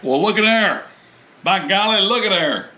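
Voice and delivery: folksy delight. Real, not acted. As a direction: show off the meat with folksy glee. Admiring the meat in the pan.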